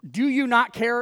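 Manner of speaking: loud, high pointed challenge; accusatory question. Acted. Preacher showing an accusatory question, loud and pointed. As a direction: ask it as an accusation, not a question. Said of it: "one of those accusatory questions".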